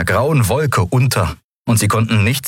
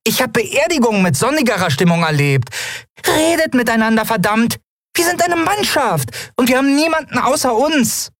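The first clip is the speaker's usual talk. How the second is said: empört schimpfendes Piepsen, sehr hoch; hohe Piepsstimme. Acted. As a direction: kleines Wesen schimpft die stille Mannschaft aus. Nelens hohe Figurenstimme schimpft. (Nelen schimpft die schweigende Mannschaft mit hoher Piepsstimme)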